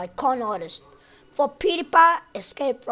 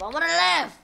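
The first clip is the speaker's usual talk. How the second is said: higher, louder desperate plea; desperate cry; 6 semitones higher, 4 dB louder, faster than usual. Real, not acted. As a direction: plead to survive, louder. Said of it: "Ah, I wanna live".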